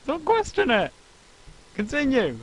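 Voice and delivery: loud, high, insistent commands; demanding outburst; excited shout. Real, not acted. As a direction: yell at him to keep going.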